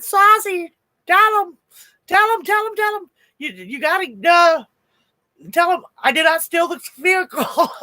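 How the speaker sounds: guy frantically begging Saucy to tell the cop he didn't steal it; panicked pleading. Retold or imitated. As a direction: plead for the friend to vouch for you, voice climbing in panic. Guy panicking as cops arrive.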